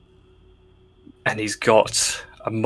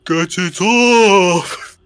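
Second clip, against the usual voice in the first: slow, loud, higher bellow; ogre shout; 8 semitones higher, 6 dB louder, much slower than usual. Retold or imitated. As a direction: bellow it out slowly. Ogre yells at halfling.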